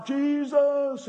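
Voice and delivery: high, loud desperate scream of the name. Retold or imitated. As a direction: scream it over the crowd. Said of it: "he shouted all the louder".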